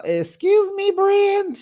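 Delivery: very high, ringing disbelief; incredulous. Acted. Vlogger challenging the cast member's remark, incredulous. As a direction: pitch it way up, affronted. Repeats shocked reaction.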